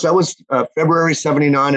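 ordinary talk, nothing marked

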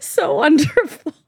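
laughing through the words; delighted, half laughing, half teary. Real, not acted. Co-host laughing with delight at her friend's crying habit. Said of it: "laugh cry".

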